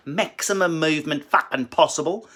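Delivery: exaggerated Australian accent with huge mouth movements; Australian impression. Comedian mocking Australians to his fans, exaggerated Australian accent. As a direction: overdo the Australian vowels, mouth wide open. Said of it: "speaking like that are Australians".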